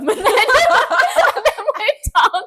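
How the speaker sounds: high, slow words squeezed out through laughter; laughing. Real, not acted. Host laughing at her own joke. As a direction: crack up mid-line. Joke cracks both hosts up.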